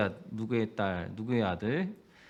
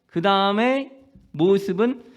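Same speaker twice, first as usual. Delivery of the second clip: fervent preaching; 3 semitones higher, 5 dB louder than usual. Real, not acted. Pastor urging the congregation, fervent preaching. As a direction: drive the message home from the pulpit.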